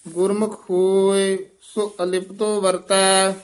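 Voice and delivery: measured chant-like reading with drawn-out line endings; scripture recitation. Shown as a demonstration. Teacher reciting Gurbani for students, measured chant. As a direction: intone each line on a level note.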